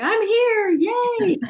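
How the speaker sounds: high, loud happy shout; excited cheer. Retold or imitated. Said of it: "You're going".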